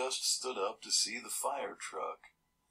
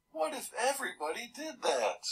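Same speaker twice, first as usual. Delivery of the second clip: much higher scolding voice, shaking his head; scolding neighbour; 14 semitones higher than usual. Retold or imitated. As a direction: put on a fussy old neighbour tsking at a kid. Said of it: "He shook his head and said".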